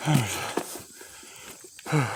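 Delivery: panting in the heat; winded, drained voice; out of breath. Real, not acted. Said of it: "watching me suck wind".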